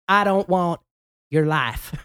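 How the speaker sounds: hammed-up film-style Southern drawl; badly faked Southern accent, played for laughs; James Van Der Beek's Southern accent. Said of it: "That's a James Van Der Beek accent trying to be".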